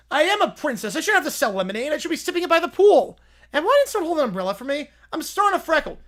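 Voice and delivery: a hint of high pampered whine; pampered princess. Princess complaining about selling lemonade, lightly high and pampered. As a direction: complain like royalty.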